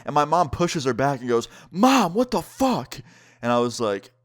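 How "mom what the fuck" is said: high, drawn-out cry of protest; retold shocked outrage. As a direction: act out an outraged daughter scolding her mom. Friend confronts mom after slap.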